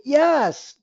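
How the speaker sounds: bright high yes; emphatic yes. Shown as a demonstration. Agent confirming to a client, high and quick. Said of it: "the only time you ever say".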